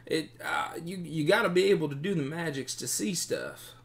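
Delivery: high, stuttering, helpless voice; nervous captive bandit. Acted. Captive bandit admits he lacks magic sight, high and pleading. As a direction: squeak out a stammered excuse.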